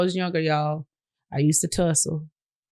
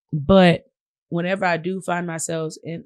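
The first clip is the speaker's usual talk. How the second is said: ordinary delivery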